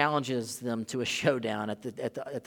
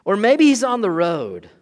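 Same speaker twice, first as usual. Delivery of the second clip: mocking taunt; 6 semitones higher, 11 dB louder than usual. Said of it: "He's mocking these".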